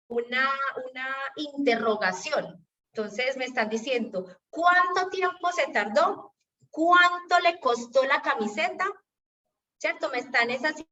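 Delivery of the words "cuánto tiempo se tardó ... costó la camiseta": raised questioning tone with weight on "Cuánto"; "Cuánto" pitched up as a clear question; question emphasis. Shown as a demonstration. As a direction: ask it pointedly, lifting "Cuánto". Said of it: "énfasis en pregunta".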